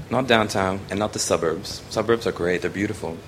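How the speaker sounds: normal talking voice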